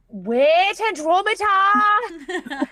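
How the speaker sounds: slow, high, loud proclamation; dramatic shout. Acted. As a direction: draw it out like a herald. Dramatic call out.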